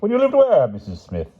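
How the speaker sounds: high, loud curious opening; sociable interest. A narrator's reading. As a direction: ask with keen sociable interest. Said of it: "Vermeer asked with sociable interest".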